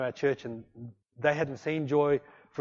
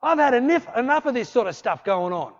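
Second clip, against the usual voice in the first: indignant; 6 semitones higher than usual. Retold or imitated. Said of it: "Tim got indignant".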